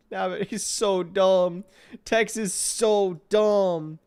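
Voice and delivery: slow, loud, laughing "so dumb"; laughing despair. Real, not acted. Gamer mocking his dim character Tex, laughing. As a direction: drag it out through laughter.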